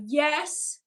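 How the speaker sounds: ordinary delivery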